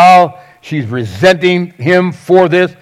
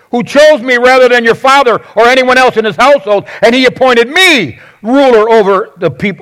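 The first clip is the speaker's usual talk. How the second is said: higher, insistent comeback; defensive; 5 semitones higher than usual. Acted. David attacking his in-laws to his wife, defensive. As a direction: press each point home in a raised pitch. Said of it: "he immediately is defensive".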